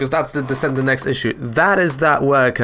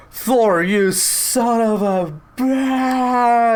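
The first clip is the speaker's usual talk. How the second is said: mock angry outburst; 9 semitones higher, much slower than usual. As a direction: blow up at Thor, dragging the last word out slowly. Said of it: "mad at Thor".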